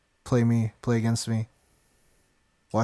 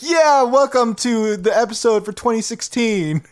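mock announcer; 10 semitones higher, 5 dB louder than usual. Mock announcer for yearly episode.

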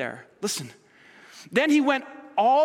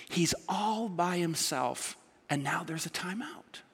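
soft whispered aside; 3 semitones lower, 8 dB quieter than usual. Real, not acted. Preacher whispering the point to his congregation. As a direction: drop to a whisper to land it.

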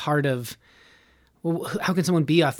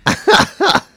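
loud laugh; 11 dB louder than usual. Real, not acted. Laughing at accent talk.

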